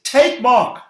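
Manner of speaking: "Take Mark" driven high and loud; with emphasis. Shown as a demonstration. Preacher quotes Paul's "Take Mark" with emphasis. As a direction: hit both words of "Take Mark" hard and high. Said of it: "say it with emphasis".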